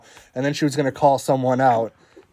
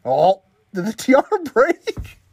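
shocked laughter; 7 semitones higher than usual. Real, not acted. Tiara mishap on screen.

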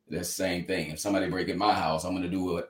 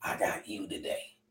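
put-on a faint, strained old man's voice, much softer; like he can hardly get the words out, much softer; barely able to talk; 13 dB quieter than usual. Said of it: "barely could talk".

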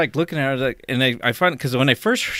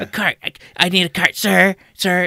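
high, insistent, louder nagging; mock Karen demand; 7 semitones higher, 5 dB louder than usual. Imitating the Karen nagging him for a cart. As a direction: pester the stranger like an entitled shopper. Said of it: "She's all".

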